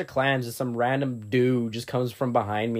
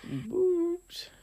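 squeaky high voice; 8 dB quieter than usual. Acted. Echoing in a high voice.

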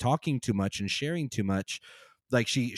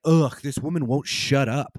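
exasperated groan; 2 semitones higher, 6 dB louder, slower than usual. Retold or imitated. Annoyed family member at dinner.

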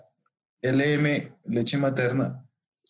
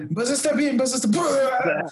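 mimicking a movie line; 10 semitones higher than usual. Quoting Deadpool 2 scene.

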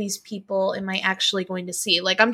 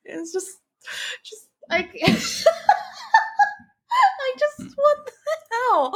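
laughing. Real, not acted. Cracking up over the trailer.

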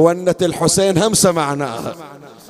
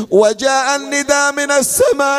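drawn-out chanted call; grieving chanted voice from the pulpit; lament recitation; 7 semitones higher, slower than usual. Acted. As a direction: stretch the cries of يا علي in mourning.

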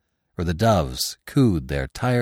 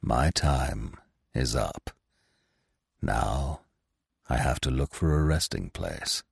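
low, soft and calm farewell; 6 semitones lower, 5 dB quieter than usual. A narrator's reading. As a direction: calmly send the young one away. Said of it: "he began with a calm voice".

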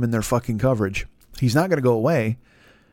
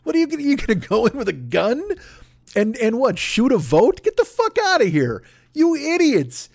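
mocking yell; 10 semitones higher than usual. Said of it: "it's like".

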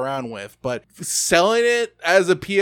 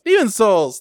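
Demon's Souls called out higher and louder; excited; 6 semitones higher, 4 dB louder than usual. Real, not acted.

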